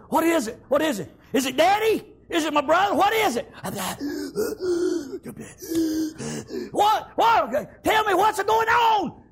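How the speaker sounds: high, loud, rapid-fire worried questions; alarmed questions. Acted. David questions the running servant.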